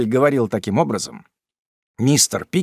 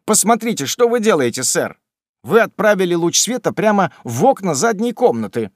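exasperated; 7 semitones higher, 5 dB louder than usual. Acted. Servant scolds master.

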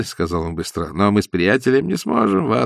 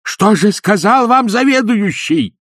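much higher, louder eager question; excited demand; 12 semitones higher, 7 dB louder than usual. A narrator's reading. Ippolit eagerly demands what the manager said, excited. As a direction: press him for the answer.

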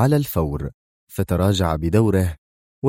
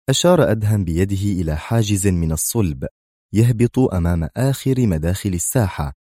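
ordinary delivery

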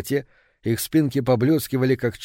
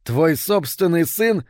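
high, drawn-out question; appalled disbelief; 6 semitones higher, 3 dB louder, slower than usual. A narrator's reading. Man confronting the woman about her own son, appalled disbelief. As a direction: stretch it out in horror.

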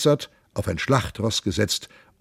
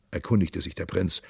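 ordinary delivery, nothing marked

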